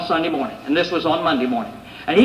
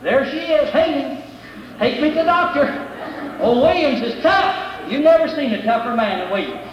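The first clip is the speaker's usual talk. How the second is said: bragging; 8 semitones higher than usual. Retold or imitated. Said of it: "And I brag".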